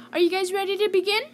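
bright-eyed storytime host revving up; excited. Real, not acted. Host kicks off kids' story.

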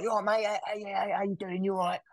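breezy high chirpy mate-to-mate hello. Retold or imitated. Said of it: "and he's like".

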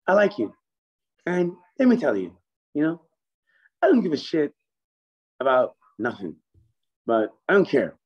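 a boss warmly giving his approval; Kenny voice. Retold or imitated. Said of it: "That's exactly like Kenny".

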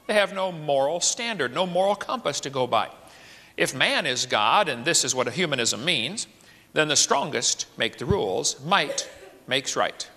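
ordinary talk, nothing marked

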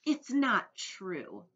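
loud, very slow astonishment; disbelieving boy. A narrator's reading. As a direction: stretch out the disbelief. Boy refuses to believe it.

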